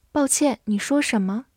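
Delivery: ordinary talk, nothing marked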